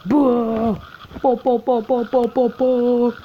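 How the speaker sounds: high, loud vocal gunfire noises; mock gun sounds. Real, not acted. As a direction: po po po like shots. Reacting to kill in game.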